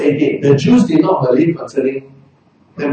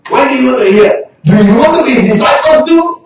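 high, louder taunting questions; mocking challenge; 8 semitones higher, 6 dB louder than usual. Healed man taunting the Pharisees, high sarcastic challenge. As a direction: needle them with sarcasm.

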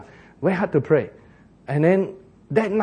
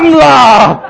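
loud, high, drawn-out mock Jesus summons; comic Singlish call; 7 semitones higher, 13 dB louder than usual. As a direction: bellow it as a Singaporean Jesus. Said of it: "And Jesus said to Peter".

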